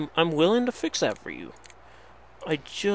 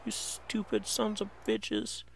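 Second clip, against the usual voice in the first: muttered insult; 5 semitones higher, 11 dB quieter than usual. Real, not acted. Insulting the wolves.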